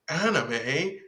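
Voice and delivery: high, drawn-out, laughing nerd voice; mock anime nerd. Teasing her friend by imitating a smug anime fan, laughing. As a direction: ham up a smug fan bragging about his anime. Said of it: "hit somebody who's like".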